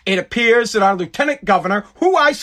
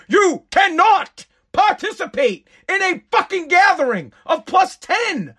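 exasperated rant; 8 semitones higher than usual. Real, not acted. Ranting at lieutenant governor.

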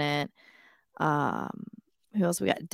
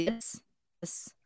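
whispering; 5 semitones higher, 12 dB quieter than usual. Real, not acted. Cheering Davey's brisket find.

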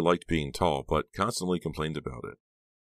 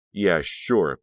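loud, dismissive sarcasm; scornful mock agreement; sarcastic; 3 semitones higher, 8 dB louder than usual. A narrator's reading. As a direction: agree while clearly meaning the opposite. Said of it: "Becca said sarcastically".

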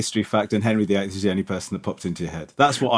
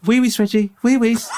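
high sing-song dog talk; puppy voice on request; 11 semitones higher than usual. Shown as a demonstration. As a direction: coax the dog in a squeaky voice. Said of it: "Go on".

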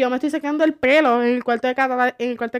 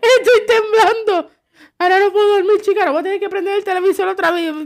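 higher, louder mock fright; mock trembling mother; 5 semitones higher, 4 dB louder than usual. Mother frightened at night, hammed-up trembling. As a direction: ham up the shaking voice.

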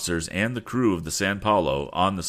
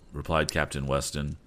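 ordinary delivery, nothing marked

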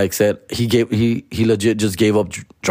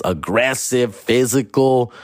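ordinary talk

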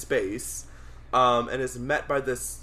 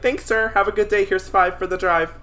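overly polite; 7 semitones higher than usual. Pretend. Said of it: "I would have been like".

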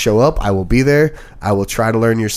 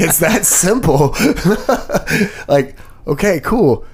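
laughing; 7 semitones higher, slower than usual. Real, not acted. Laughing at the story.

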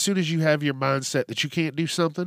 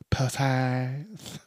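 hushed, whispered aside; mock whisper; 4 dB quieter than usual. Listing moist things to listeners, naughty whisper. Risqué word dropped in low.

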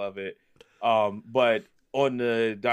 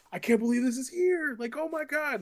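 imitating a fan, far higher, breathless amazement; so excited. Fan finds the one Andre figure on the shelf, high awed squeal. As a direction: squeal in awe at a rare find. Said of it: "he was so excited".

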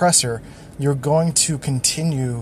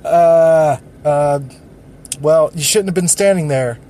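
dismissive deflection; 5 dB louder than usual. Retold or imitated. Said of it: "So you're like".

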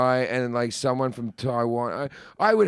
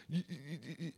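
imitating a stutter; 20 dB quieter, much faster than usual. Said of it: "he was like".

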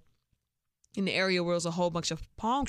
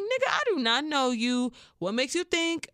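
indignant inner rant; 8 semitones higher, 4 dB louder than usual. Retold or imitated.

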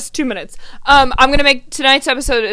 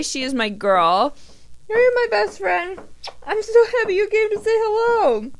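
dog voice; 8 semitones higher than usual. Real, not acted. Host greeting her dog Holly who came to say hello, dog voice. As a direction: coo at a beloved dog in a much higher voice. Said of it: "having to hear my dog voice".

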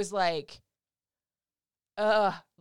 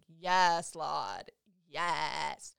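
low, drawn-out praise; deeply relieved, savoring each yes; retold relief; 3 semitones lower, much slower than usual. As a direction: exhale it like an answered prayer. Retold relief at getting epidural.

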